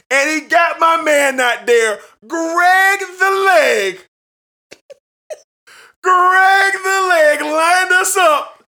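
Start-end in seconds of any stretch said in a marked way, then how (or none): 2.3-8.6 high, loud, slow hype call; hype announcer. Real, not acted. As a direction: drag out "Greg the Leg" like a ring announcer. Hyping the kicker.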